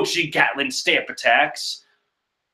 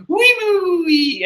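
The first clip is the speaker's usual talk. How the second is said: high squeal; 14 semitones higher than usual. Retold or imitated.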